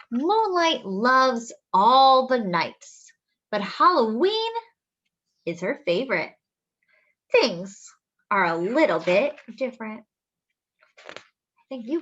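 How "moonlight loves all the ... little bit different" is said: slowing gently as she reads; storybook reading. Acted. Reading to children, slowing and gentle.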